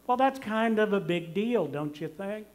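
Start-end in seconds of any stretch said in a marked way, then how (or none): none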